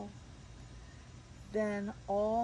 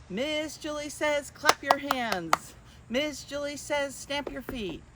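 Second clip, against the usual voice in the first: higher, bouncy game-caller voice; game leader; 5 semitones higher, 3 dB louder than usual. Acted. Miss Julie calling commands to children, playful. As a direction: keep the kids guessing. Leads Simon Says game.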